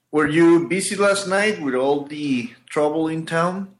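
ordinary talk

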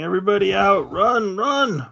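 high-pitched urgent yell to clear out. Acted. Player voicing a character getting everybody out the door, yelling. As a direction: shout it as an acted alarm, pitch climbing. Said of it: "I will start yelling".

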